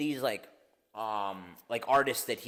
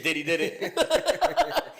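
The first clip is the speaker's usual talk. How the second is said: slogan through laughter; 6 semitones higher, 8 dB louder, much slower than usual. Real, not acted. Pitching a t-shirt slogan, laughing.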